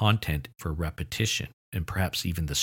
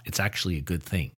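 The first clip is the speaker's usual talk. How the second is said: ordinary talk, nothing marked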